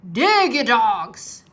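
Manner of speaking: very slow, loud barked order; captain yells at his crew; pirate captain shout. A narrator's reading. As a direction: drag out the angry order. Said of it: "cried Captain Bones".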